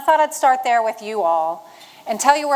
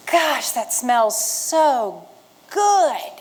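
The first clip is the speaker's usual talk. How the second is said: savoring; much slower than usual. Retold or imitated. Savoring the smell of cows.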